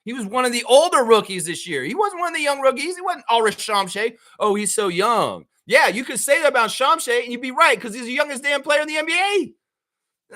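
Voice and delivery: high, insistent protest; exasperated rebuttal. Real, not acted. Fan rebutting claim that the rookie is young, exasperated. Arguing against the "young" excuse.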